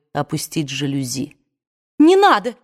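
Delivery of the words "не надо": higher and louder, furious; furious cry. A narrator's reading. Scarlett stops her aunt at the window, furious cry. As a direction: snap it out in fury. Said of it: "яростно вскричала вдруг Скарлетт".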